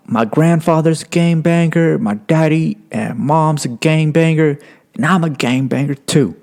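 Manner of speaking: put-on high-pitched boastful kid voice; imitated teen gangbanger. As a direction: declare it proudly as a young kid. Acting the thirteen-year-old boasting.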